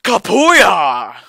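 loud, high triumphant whoop; mock battle cry. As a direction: blast it out like a victory yell. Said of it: "and I'm just like".

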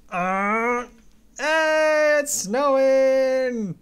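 high, loud, very slow it's snowing; delighted. Real, not acted. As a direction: stretch it out in pure delight. Excitedly showing the snow outside.

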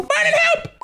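put-on high pleading baby call. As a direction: squeal for help like a toddler. Baby calls for help.

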